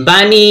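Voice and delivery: "বানি" with a long held "নি"; drawn-out vowel. Shown as a demonstration. Teacher shows learners the long "নি", drawn out. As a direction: stretch the end of "বানি". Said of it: "এখানে একটু টেনে পড়বো".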